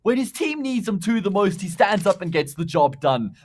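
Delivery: loud, high hype call; excited shout. Real, not acted. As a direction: scream the clutch call. Clutch round called at peak.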